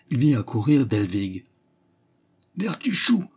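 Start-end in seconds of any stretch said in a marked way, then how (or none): 2.6-3.2 high astonished call; surprised cry. A narrator's reading. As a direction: shout his name in shock. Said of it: "crie le régisseur au comble de la surprise".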